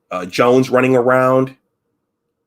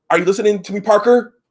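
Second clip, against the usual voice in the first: high, louder imitated snap at Parker; mock stern shout; 11 semitones higher, 5 dB louder than usual. Officer silencing an angry crewman, mock stern shout. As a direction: bark it at him, pitched way up. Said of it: "Like that".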